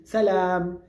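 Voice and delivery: salam said slowly and clearly, both syllables even; Arabic greeting. Shown as a demonstration. Teacher modeling salam for Spanish-speaking learners, clear. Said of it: "para decir hola tenemos una opción".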